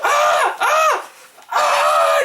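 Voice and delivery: high shouted mock labor screams; hammed-up birth-pain yells; Aunt Viv screaming. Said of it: "She was like".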